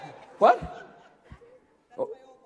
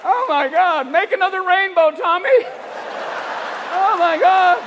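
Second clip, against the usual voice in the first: high, loud, giddy wonder; mock stoned amazement; 9 semitones higher, 6 dB louder than usual. Stoned firefighter begging Tommy for rainbows, high giddy amazement. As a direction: marvel loudly, pitched way up.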